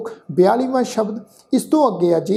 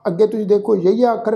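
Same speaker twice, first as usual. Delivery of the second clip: normal talking voice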